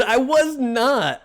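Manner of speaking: slow, high-pitched protest; affronted and emphatic; indignant denial. Real, not acted. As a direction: deny it hotly, drawing out each word. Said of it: "Sounds like you were baited".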